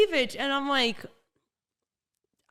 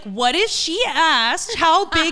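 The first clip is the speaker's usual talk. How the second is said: high, loud, slowed-down challenge; incredulous retort; 8 semitones higher, 6 dB louder than usual. Retold or imitated. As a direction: spell out the absurdity, outraged. Indignant retort retold.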